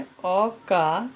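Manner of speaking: spelled out very slowly; slow dictation. Shown as a demonstration. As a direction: dictate each sound slowly for the class. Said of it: "हमारा second rule है". (धीरे-धीरे बोलकर समझाना)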